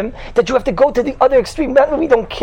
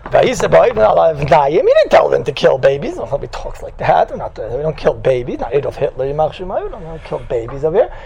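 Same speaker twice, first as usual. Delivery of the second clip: sly Pharaoh; 5 semitones lower than usual. Retold or imitated. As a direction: murmur it like a smooth tyrant covering his tracks. Mocking Pharaoh's smooth denial.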